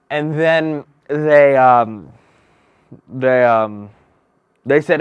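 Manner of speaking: booming mock fairy-tale narrator; storybook narration. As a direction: tell it like a bedtime tale to a big room.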